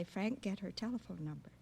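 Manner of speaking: usual speaking voice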